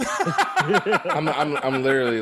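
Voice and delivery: talking through a high, loud laugh. Real, not acted. Friend scrolling to find the episode, laughing. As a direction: get the words out through real laughter.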